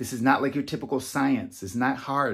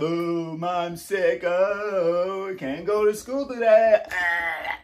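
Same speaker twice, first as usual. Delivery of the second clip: higher, whiny put-on sick child; hammed-up sick groan to Ma; faking-sick kid; 5 semitones higher, much slower than usual. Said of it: "instead of".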